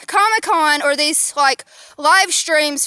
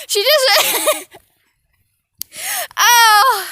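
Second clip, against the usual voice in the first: laughing; 8 semitones higher, much slower than usual. Real, not acted. Laughing after the race.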